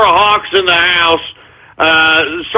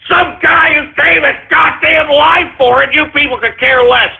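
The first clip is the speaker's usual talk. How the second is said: angry shout; 7 semitones higher than usual. Real, not acted. Angry outburst at listeners.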